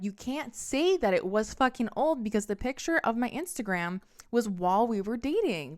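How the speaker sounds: loud, high, indignant comeback; angry retort. Retold or imitated. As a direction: snap back at him in disbelief. Confronting cheating boyfriend in car.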